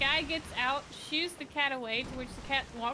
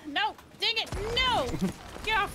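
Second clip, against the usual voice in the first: frustrated shout; 8 semitones higher than usual. Real, not acted. Player gets hit.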